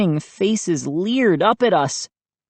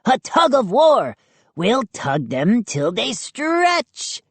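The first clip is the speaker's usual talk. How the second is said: shouted; 6 semitones higher than usual. A narrator's reading. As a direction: call it out high, stretching the words. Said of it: "Chip shouted".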